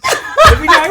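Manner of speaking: high, loud Boston voice; hammed-up Boston guy; Boston accent. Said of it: "like a Boston accent".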